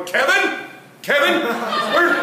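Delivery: hammed-up dad hunting for a missing twin; mock searching parent. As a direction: call for a lost kid in the dark. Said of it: "walk around all night going".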